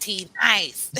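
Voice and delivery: "nice" in a lightly put-on mocking voice. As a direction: poke fun at the word "nice". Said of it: "I'm mocking on that one".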